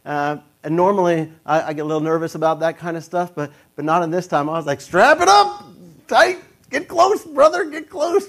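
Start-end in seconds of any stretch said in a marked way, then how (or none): 4.8-8.3 high, loud hype to a buddy; gung-ho mock shout. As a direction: egg him on in a high, drawn-out yell. Acts out eager strapping-in.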